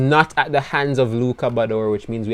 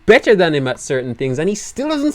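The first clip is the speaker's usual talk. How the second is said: exasperated whine; 10 semitones higher, 4 dB louder than usual. Real, not acted. Exasperated rant about Bador.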